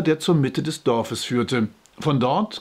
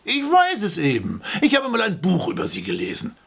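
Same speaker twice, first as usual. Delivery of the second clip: higher-pitched, insistent comeback; defensive retort; 7 semitones higher than usual. A narrator's reading. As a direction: insist in your own defence. (trotzig beharrend, mit höherer Stimme)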